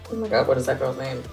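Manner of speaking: ordinary talk, nothing marked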